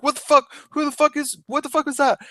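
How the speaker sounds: high, bewildered retold swearing; startled outburst. Man just hit on the head, retold startled outburst. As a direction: act out demanding what just hit you.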